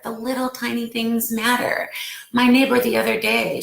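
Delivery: ordinary talk, nothing marked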